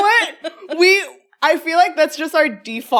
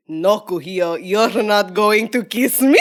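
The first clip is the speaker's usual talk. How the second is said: put-on low, accidentally Russian grandma accent; imitated grandma with heavy Russian lilt; oma turned Russian; 8 semitones lower than usual. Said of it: "Why was that Russian?".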